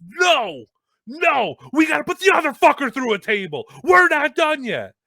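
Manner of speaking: high, loud insistent shout; Kevin Graham yelling. Retold or imitated. Colleague demanding another table spot, yelling. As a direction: yell refusal, stretching the end. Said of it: "Kevin Graham's yelling".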